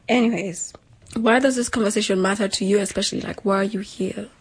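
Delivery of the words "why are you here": ordinary talk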